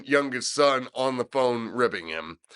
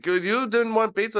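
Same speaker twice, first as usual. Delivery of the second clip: higher-pitched mock Indian accent; 10 semitones higher than usual. [name] on the prank call as the manager, mock Indian accent. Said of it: "Parsi or Indian accent".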